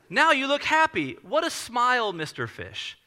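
high, loud, delighted story-time finish; picture-book reading. Retold or imitated. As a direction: praise a child through the storybook. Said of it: "Let me read it to you".

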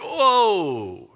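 long, falling, startled whoa; taken aback. Acted. Messenger is stopped short by the king's question, taken aback. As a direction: draw it out, sliding down in pitch. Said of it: "it's like the record stops".

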